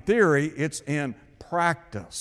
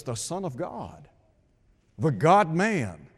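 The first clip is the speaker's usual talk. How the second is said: ordinary talk, nothing marked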